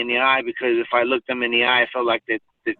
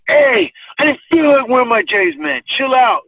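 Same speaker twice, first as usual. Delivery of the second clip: retold yell; 14 semitones higher, 6 dB louder than usual. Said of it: "and yelled at him".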